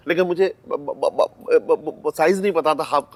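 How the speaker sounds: stuck on a repeated b sound; nervous stutter. Acted. Man explaining why he needed her hand size, stammering. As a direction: stumble repeatedly before getting the excuse out.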